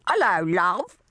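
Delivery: a deliberately awful put-on English accent; horrible English accent. Becky mocks Felix with a terrible English accent. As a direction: ham up a bad English accent, cooing the greeting. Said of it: "in a really horrible English accent".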